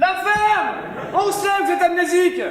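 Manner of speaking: shouting in anger, high and loud; angry shout. Acted. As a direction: snap at him furiously. Fed up with amnesiac guide.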